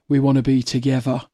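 low-pitched take on a dad's catchphrase; imitating dad. Daughter imitating dad repeating an advert line, lightly voiced dad. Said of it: "my dad would wander around going".

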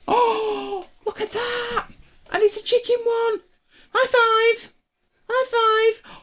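very high squeaky praise for the dog; overwhelmed by cuteness. Real, not acted. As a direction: squeal delight at the dog's trick. Dog gets chicken treat.

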